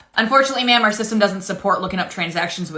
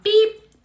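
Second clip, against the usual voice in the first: scanner beep; 12 semitones higher, 3 dB louder than usual. Pretend. Cashier imitates scanner beep.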